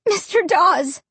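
high-pitched, emotional address; relieved child. A narrator's reading. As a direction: start gratefully, overcome with relief. Relieved child starts to speak.

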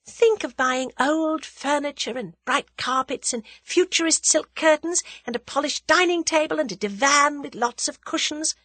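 high-pitched daydreaming list; enraptured, dreamy musing. A narrator's reading. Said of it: "said Tuppence dreamily".

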